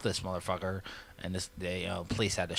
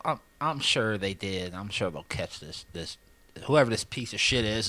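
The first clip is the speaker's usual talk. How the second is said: ordinary delivery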